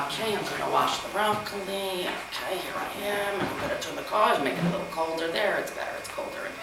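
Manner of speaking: lightly imitated stepmother talking to herself; soft running commentary while cooking; mildly mock, under-the-breath self-narration; narrating every kitchen step. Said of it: "will repeat everything that she's doing".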